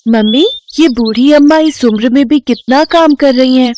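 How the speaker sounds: put-on a curious little girl pointing something out; young girl.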